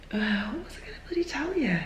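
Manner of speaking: soft, high, whispered grumble to herself; whispered self-mutter. Real, not acted. Trying to remember what to tell viewers, whispering. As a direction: mutter under your breath, annoyed.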